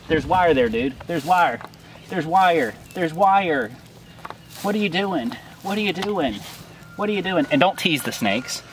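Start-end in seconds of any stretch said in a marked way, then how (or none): 1.1-3.7 urgent warning. Real, not acted. Warning snake about wire. 4.6-7.5 high, exasperated What are you doing; disbelieving cry at the wandering snake; pitched-up exasperation at the snake; incredulous. Real, not acted.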